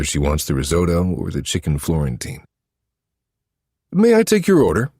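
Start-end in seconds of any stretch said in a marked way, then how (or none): 3.9-4.9 high, polite waiter voice; bright, loud service-staff ask. Acted. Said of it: "the waiter asks".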